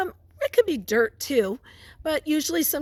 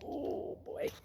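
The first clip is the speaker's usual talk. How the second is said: hushed, awed whisper; whispered wonder; 22 dB quieter than usual. Acted. Teacher shows kids the picture, whispered amazement. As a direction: gasp it out softly in wonder.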